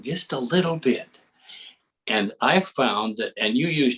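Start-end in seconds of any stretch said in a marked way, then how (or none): 0.0-1.1 slowed down. Shown as a demonstration. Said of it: "if you can slow it down".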